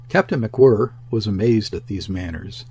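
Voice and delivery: ordinary delivery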